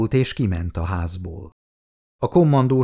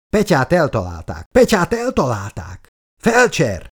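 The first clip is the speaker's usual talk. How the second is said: yelled alarm; 5 semitones higher, 3 dB louder than usual. A narrator's reading. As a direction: cry for the medic, climbing higher. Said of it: "ordította Kis".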